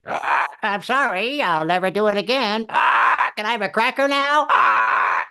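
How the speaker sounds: high squawking parrot repeating back. Retold or imitated. Husband repeating wife's apology, as a squawking parrot. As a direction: squawk it like a parrot begging a cracker. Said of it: "I felt like a freaking parrot".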